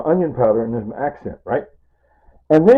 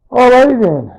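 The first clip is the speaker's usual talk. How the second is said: much higher and louder, sing-song alrighty then; chirpy alrighty; 13 semitones higher, 10 dB louder than usual. Real, not acted. Vlogger switching scenes, cheerful loud alrighty. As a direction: bounce into the next bit.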